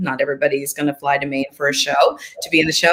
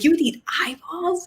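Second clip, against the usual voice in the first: much higher, playful astonishment; mock surprise; 11 semitones higher, 4 dB quieter than usual. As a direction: gasp it like it's the wildest idea. Mother playing along, joking.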